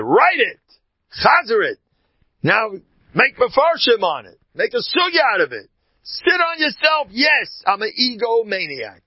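loud, high fiery exhortation; a preacher roaring at his listeners; full-force preaching. Acted. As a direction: hammer it home from the pulpit.

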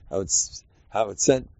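ordinary talk

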